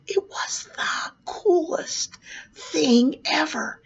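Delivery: slow, hushed whisper of wonder; awed whisper. Retold or imitated. Clown remembering the coolest flight ever, slow awed whisper. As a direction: breathe it out, still amazed.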